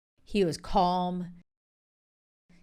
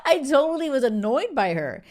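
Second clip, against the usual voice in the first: laughing, higher and louder; 7 semitones higher, 5 dB louder than usual. Real, not acted. Vlogger laughs to viewers about disliking Lydia, laughing. Laughing at her past self.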